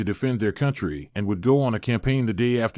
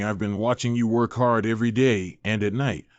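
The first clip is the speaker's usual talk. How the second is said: ordinary delivery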